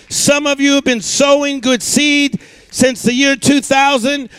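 ordinary delivery

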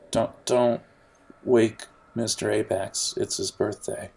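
ordinary delivery

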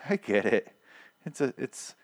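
normal talking voice

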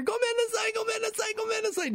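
very high squealed imitation; frantic apology. Class hears the boy down the hallway, frantic high apology. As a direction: repeat the sorry in a high, distant-sounding plea. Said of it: "As we hear".

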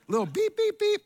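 high little beep beep beep; mock electronic beeping; car beep imitation. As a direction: chirp it like a car warning beep.

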